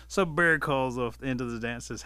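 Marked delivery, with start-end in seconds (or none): none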